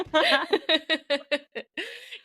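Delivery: high, long laugh; drawn-out high giggle. Real, not acted. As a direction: laugh heartily at yourself. Laughs at own crying.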